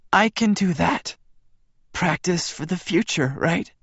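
high, frail joking woman; weak Bella voice. A narrator's reading.

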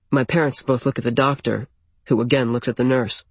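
usual speaking voice

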